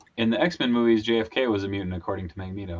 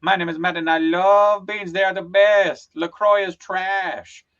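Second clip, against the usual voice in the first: higher, louder, drawn-out mock declaration; Matt; 6 semitones higher, 4 dB louder, slower than usual. Putting words in his friend's mouth, loud slow mockery. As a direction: proclaim it slowly, stretching the key words. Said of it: "you say".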